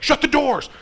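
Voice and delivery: high, loud imitated yell of the grandparents; mock panicked shout. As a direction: shout it like the store is in chaos. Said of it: "They were like".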